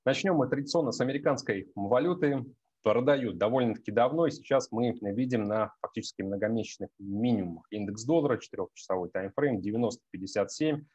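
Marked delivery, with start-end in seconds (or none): none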